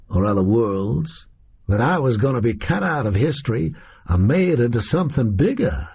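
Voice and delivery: casual American gunman telling his own history; American captor. Acted. Oswald tells how he was cut from history, American captor voice. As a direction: recount it in a loose American accent.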